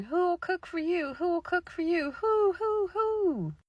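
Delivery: high hooting witch call; owl-witches calling. Acted. Witches turned owls calling through the woods, high and hooting.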